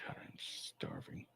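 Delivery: whispered, hushed, low; whispered aside. Real, not acted. Streamer whispering an aside about hunger. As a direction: whisper it to yourself. Aside while reading news.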